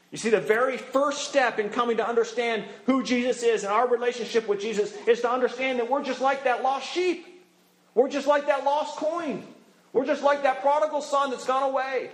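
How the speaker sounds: impassioned appeal. Real, not acted. Preacher urging the congregation, voice climbing.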